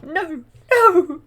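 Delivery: high, loud mock wail of no; anguished cry. Aurora hearing she can't see him, loud high anguished cry. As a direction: cry out like a heartbroken princess. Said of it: "She's like".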